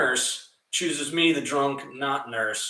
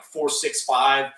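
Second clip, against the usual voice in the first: slow fake address; much slower than usual. Giving fake address slowly.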